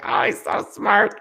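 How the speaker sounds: high, breathy gushing praise; doting girlfriend. Retold or imitated. Girlfriend gushing about the son, high airy admiring voice. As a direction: gush over the clever kid. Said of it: "My girlfriend's like".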